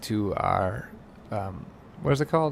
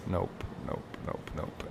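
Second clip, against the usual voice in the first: fading, much softer into a whispered nope; muttered nopes; 7 semitones lower, 13 dB quieter than usual. Real, not acted. Gamer closing the buggy gate, muttering softer and softer nopes. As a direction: trail off under your breath.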